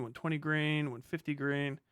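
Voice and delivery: normal talking voice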